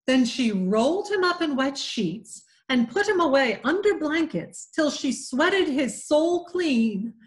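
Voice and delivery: usual speaking voice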